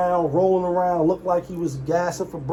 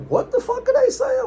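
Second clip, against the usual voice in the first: sudden squeaky, high disbelief; a confused yelp cutting through the story; baffled outburst. Real, not acted. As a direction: shoot way up in pitch like you can't believe it. Baffled by the twins' clip.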